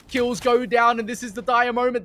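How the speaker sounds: building to a screaming clutch call; esports caster. Acted. Clutch moment caster peak.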